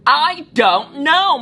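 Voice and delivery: loud, slow, high cry; shouted. Acted. Mulan shouting back at the teasing children, a loud slow cry. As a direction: shout it out in frustration. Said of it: "Mulan shouted".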